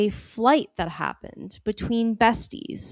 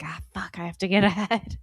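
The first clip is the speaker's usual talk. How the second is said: hushed, panicky whispered curse; panicked whisper; 2 semitones lower, 5 dB quieter than usual. Retold or imitated. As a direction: whisper as if caught unprepared. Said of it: "and I'm like".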